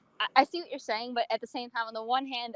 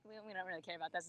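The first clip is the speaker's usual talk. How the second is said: much softer, quick, offhand throwaway; mock audience brush-off; 3 semitones lower, 18 dB quieter, faster than usual. Imitating the audience shrugging off a favourite audio, softer and fast. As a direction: toss it off quietly and fast, like you couldn't care less. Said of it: "and they're like".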